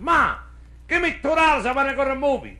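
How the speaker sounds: put-on high, loud kid pestering Ma; eager, high-pitched child's plea; demanding kid. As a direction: call out to Ma, wanting money. Kid demanding money from his mother.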